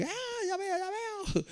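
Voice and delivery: very high, soft squeak of a mock character; a very high mocking falsetto; mock squeaky voice. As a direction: squeak it out like a silly cartoon figure. Mock reply in a joke.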